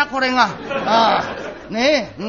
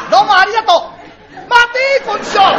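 much higher, loud call; 12 semitones higher, 11 dB louder than usual. Acted. Man calling out loudly to greet someone. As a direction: shout across the room.

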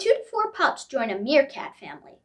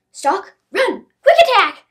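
excited; 7 semitones higher, 12 dB louder than usual. A narrator's reading. Narrating lion cubs' play hunt.

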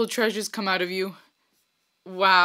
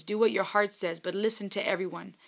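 usual speaking voice

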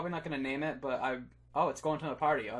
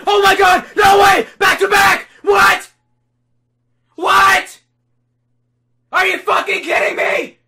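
screaming in disbelief, much higher and louder; ecstatic shouting; 22 dB louder than usual. Real, not acted. Streamer screaming at chat over a back-to-back shiny, ecstatic yelling. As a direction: lose it completely, yelling at the screen.

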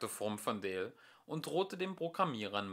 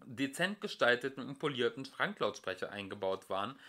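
ordinary delivery

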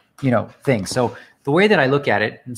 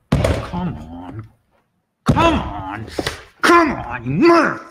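rising frustration; 4 dB louder, much slower than usual. Real, not acted. Losing the hand to lag.

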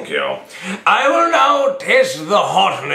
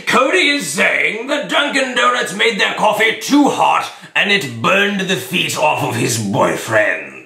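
booming judge; 3 semitones higher than usual. Acted. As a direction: proclaim it like a pompous courtroom judge. Judge announcing in court.

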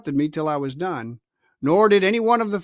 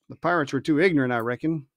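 ordinary delivery, nothing marked

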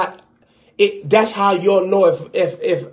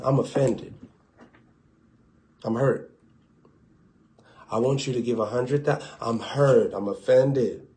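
mock hurt; 4 semitones lower, 6 dB quieter than usual. Believer voiced sulking, hurt.

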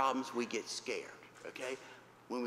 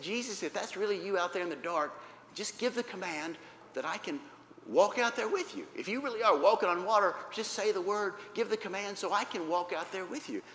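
higher, urgent, insistent request; eager plea; 5 semitones higher, 4 dB louder than usual. Acted. Peter asking Jesus to call him, eager plea. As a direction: plead to be allowed out there.

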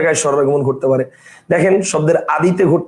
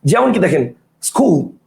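usual speaking voice

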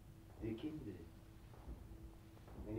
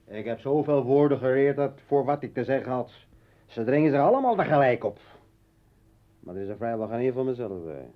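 much louder, rushing the words out; loud outburst; 12 dB louder than usual. Acted. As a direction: pour it out in a loud torrent. Uitbarsting over woorden. (luide, gehaaste uitbarsting)

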